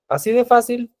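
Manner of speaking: normal talking voice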